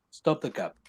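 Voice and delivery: normal talking voice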